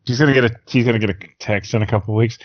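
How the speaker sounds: ordinary delivery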